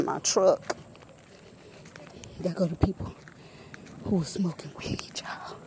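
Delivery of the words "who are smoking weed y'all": hushed whisper. Real, not acted. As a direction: lean in and whisper it. Quietly pointing out nearby people.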